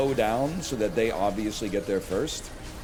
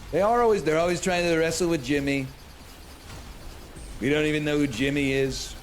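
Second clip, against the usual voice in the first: mock voice; 5 semitones higher than usual. Streamer mocking a Gen Z habit, playful put-on voice. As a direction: mimic them playfully. Reading chat joke mock style.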